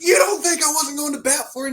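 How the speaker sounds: high mock shout; retold indignant yell; imitated angry coach. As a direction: fire back as the offended coach. Retold coach snapping back.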